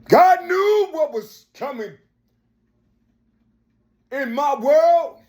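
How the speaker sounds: fiery sermon cadence rising to shouts; preaching. Acted. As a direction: preach it hard to the crowd. Preaching at full force.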